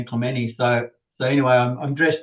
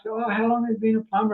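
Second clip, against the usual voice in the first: higher-pitched imitation of customers; inquiring customer; 9 semitones higher than usual. Customers greeting the new plumber, imitated curious higher voice. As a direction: lift the voice into a chatty customer's. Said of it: "they always seem to ask".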